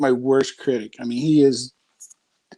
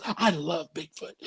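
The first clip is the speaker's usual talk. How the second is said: laughing; 9 dB quieter, slower than usual. Retold or imitated. Son quoted, dad laughing.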